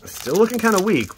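high mock whine. Real, not acted. Complaining to viewers about a weak box, high mock whine. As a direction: whine it playfully.

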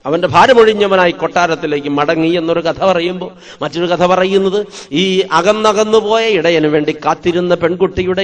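ordinary talk, nothing marked

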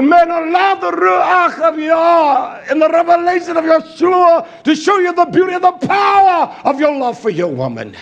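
shouted, high-pitched sermon at full force; preacher shouting down the pulpit; fiery preaching. Acted. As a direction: thunder it at the congregation.

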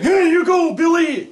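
put-on high, loud cheery old man; cheerful old grandpa. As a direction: call out like a happy grandpa. Old guy at soccer game.

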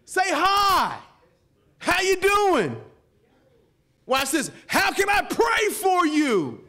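high, loud, slow preacher's call; full-force preaching. Acted. Pastor urging the church to greet strangers, high and loud. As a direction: drive it home to the congregation.

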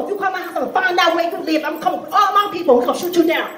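indignant, sassy woman cutting in high; Bonquisha from Detroit. Acted. Bonquisha telling off the bill collector, indignant and high-pitched. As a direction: rattle off excuses, rising into threats. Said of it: "Bonquisha lived in Detroit".

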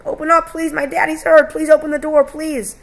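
high, loud pleading yell. A narrator's reading. Boy begging at neighbor's door for hurt daddy, pleading yell. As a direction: beg at full voice to be let in.

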